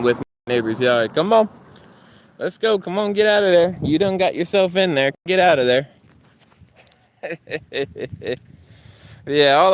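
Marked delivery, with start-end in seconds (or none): 1.1-5.9 raised, higher-pitched commands to a dog; calling the dog. Real, not acted. Calling dog out of neighbour's yard. 7.2-8.4 soft chuckle. Real, not acted. Amused after calling the dog.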